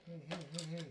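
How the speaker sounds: ordinary delivery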